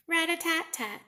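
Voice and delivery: high rat-a-tat; drum sound. Acted. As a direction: tap it out high.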